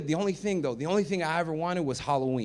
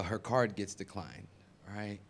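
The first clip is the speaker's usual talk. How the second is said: usual speaking voice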